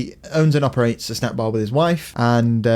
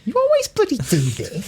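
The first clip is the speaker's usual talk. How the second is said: mock whine; 12 semitones higher than usual. Mock-whining partner's complaint.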